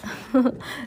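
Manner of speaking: brief, breathy villain's chuckle; acted chuckle. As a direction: let out a small put-on laugh. Stefano's laugh acted in narration.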